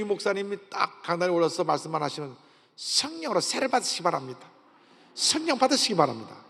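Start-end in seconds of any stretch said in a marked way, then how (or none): 2.7-6.4 high-pitched imitation of a Gyeongsang-accented preacher. Pastor exhorting from the pulpit, imitated in strong Gyeongsang accent. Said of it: "강한 경상도 액센트와 함께 성령을 받으라고".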